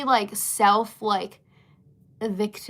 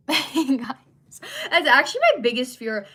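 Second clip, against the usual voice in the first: talking through a laugh, higher and louder; amused, loud outburst; 5 semitones higher, 7 dB louder than usual. Real, not acted. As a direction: laughingly confess to the audience. Laughing over booger comments.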